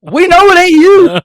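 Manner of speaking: high, loud holler; exasperated shout. Acted. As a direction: yell it at the fakers. Calling out fake photo posters.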